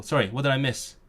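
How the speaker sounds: usual speaking voice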